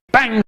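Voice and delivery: sharp high bang; gunshot noise. Retold or imitated. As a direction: spit out a gunshot. Gunshot imitation.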